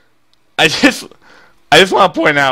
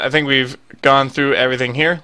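ordinary talk

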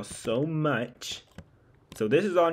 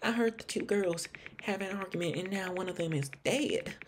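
put-on high-pitched Southern accent; Lauren; 6 semitones higher, 4 dB quieter than usual. Hotel guest answering an investigator, in a high Southern accent. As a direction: voice her higher, with a put-on Southern twang. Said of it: "she sounds Southern".

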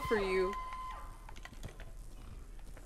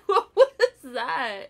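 slow, louder, laughing through it; 7 semitones higher, 7 dB louder, much slower than usual. Real, not acted. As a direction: crack up while asking. Laughs at partner's move.

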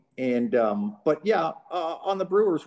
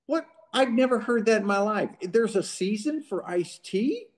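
amazed disbelief; 9 semitones higher than usual. Retold or imitated. Robin retold, disbelief.

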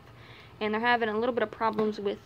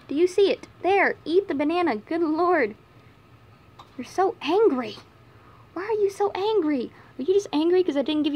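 higher and louder playful scolding; teasing pet talk; 6 semitones higher, 5 dB louder than usual. Real, not acted. Vlogger playfully scolding the angry mama bunny. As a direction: tease the grumpy bunny.

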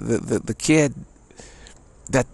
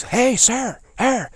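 high-pitched imitation of a policeman yelling; mock-yelled order to clear the road; retold yelling; 8 semitones higher than usual. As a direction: call out urgently, pitched way up, as the officer. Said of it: "yelling real strong at me".

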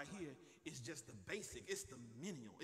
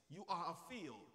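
ordinary talk, nothing marked

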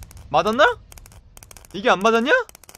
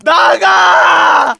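long, high, loud pleading yell; drawn-out shout; 7 semitones higher, 7 dB louder, much slower than usual. Real, not acted. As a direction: stretch out a desperate shout. Player begging character to escape.

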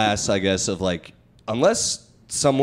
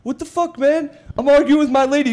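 high, indignant hammed-up bark; mock angry yell; 14 semitones higher, 4 dB louder than usual. Driver arguing with his lady snaps at stranger, high indignant yell. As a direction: play the driver fed up mid-argument. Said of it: "And the dude goes".

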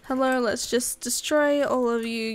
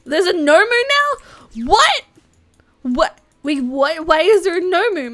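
high, loud shocked disbelief, voice cracking; 5 semitones higher, 7 dB louder than usual. Real, not acted. Player shocked that a Nomu appeared, voice cracking. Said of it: "My voice is just cracking right now".

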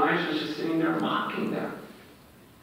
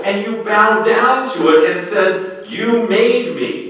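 louder and higher, incredulous scolding; exasperated divine rebuke; God rebuking; 5 semitones higher, 9 dB louder than usual. Retold or imitated. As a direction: scold them for the idol. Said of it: "He says".

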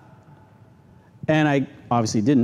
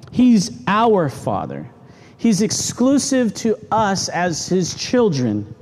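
ordinary talk

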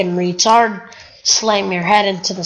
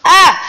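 sudden yelp; 8 semitones higher, 8 dB louder than usual. Real, not acted. Sudden scream while playing.

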